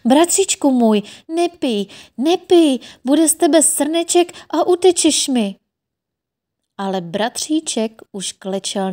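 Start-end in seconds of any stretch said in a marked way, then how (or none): 0.1-5.6 high, loud alarmed pleading; frightened cry. A narrator's reading. Sister cries out in alarm to her brother. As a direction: cry out in fright to stop him. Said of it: "zvolala polekaně".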